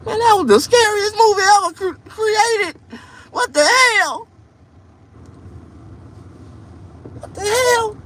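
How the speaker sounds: very high, loud squealing rant; high frightened squeal. Acted. Complaining about Wizard of Oz to audience, high squeal. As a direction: squeal like the movie still scares you.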